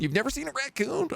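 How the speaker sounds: very high rising disbelief; astonished high question; surprised. Real, not acted. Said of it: "surprise at chat".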